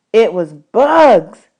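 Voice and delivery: ordinary delivery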